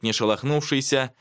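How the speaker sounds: ordinary delivery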